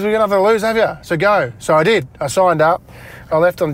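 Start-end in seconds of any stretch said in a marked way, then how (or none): 0.0-1.5 higher, louder retold push; raised-pitch, emphatic nudge; insistent urging. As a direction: imitate a partner insisting, with more force. Partner repeats the urging, retold.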